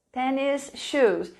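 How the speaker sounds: "Tennis shoes" said slowly, both words clear; slow, clear teacher model; careful English pronunciation. Shown as a demonstration. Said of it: "In Portuguese, we say tennis".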